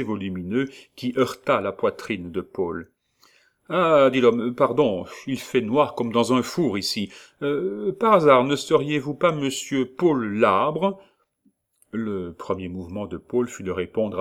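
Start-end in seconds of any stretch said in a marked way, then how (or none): 3.7-11.0 loud, high surprised man on stairs; startled stranger. Acted. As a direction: bump into him and apologise loudly.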